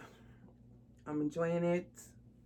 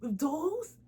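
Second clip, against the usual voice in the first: mock amazed stranger; 9 semitones higher than usual. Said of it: "most people that's like".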